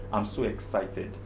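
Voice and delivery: usual speaking voice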